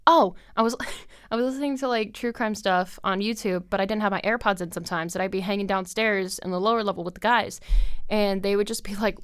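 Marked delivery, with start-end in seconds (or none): none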